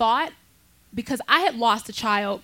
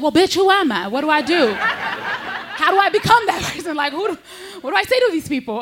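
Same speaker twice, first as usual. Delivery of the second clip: laughing exasperation; 7 semitones higher than usual. Retold or imitated. Comic answering the synchronologist, laughing high exasperation. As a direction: throw it back laughing in disbelief.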